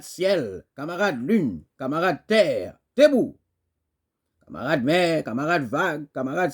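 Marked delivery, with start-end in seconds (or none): 2.9-3.4 high, loud one-word command; rallying shout. Acted. Poet calling comrade earth to rise, shouted. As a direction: burst out as if rousing a crowd.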